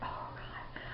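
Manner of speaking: anxious whispered "Oh, God". Retold or imitated. Waiting nervously for her dog, whispering. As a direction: breathe it out, voiceless. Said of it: "and I'm like".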